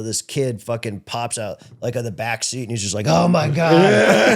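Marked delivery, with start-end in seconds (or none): 3.1-4.4 mock startled friend. Kid pops out of back seat.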